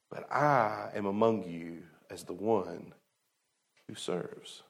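hushed and slow, fading to a breath; soft reverent. Acted. Preacher softly reading Jesus's words about serving, hushed. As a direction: let it fall to almost nothing.

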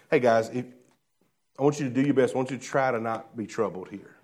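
soft, low, casual request; mild, unassertive suggestion. Acted. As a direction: make it a polite, easygoing suggestion, quietly. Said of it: "That's not Jesus' tone".

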